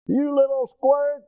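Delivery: high, drawn-out mock tirade; lighting into someone. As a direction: imitate an angry man's insult, slowly. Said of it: "he lit into me".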